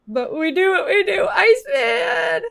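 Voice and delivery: high, loud, drawn-out cry; excited shout. Real, not acted. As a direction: whoop it out to chat. Excited cheer on stream.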